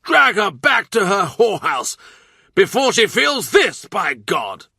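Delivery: loud, high, enraged threat; furious shout. Acted. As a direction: bark it through clenched teeth. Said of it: "teeth clenched, fist raised".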